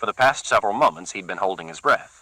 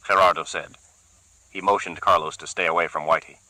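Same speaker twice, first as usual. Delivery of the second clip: usual speaking voice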